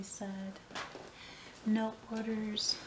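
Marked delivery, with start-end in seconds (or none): none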